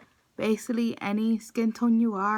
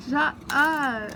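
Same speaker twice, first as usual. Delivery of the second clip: laughing; 4 semitones higher, 5 dB louder than usual. Real, not acted. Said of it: "I can't stop laughing".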